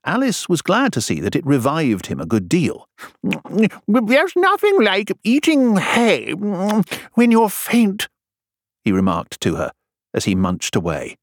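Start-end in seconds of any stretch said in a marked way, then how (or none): 3.9-8.1 high, slow character voice; high, drawn-out storybook king; munching king. Acted. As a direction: draw it out between munches.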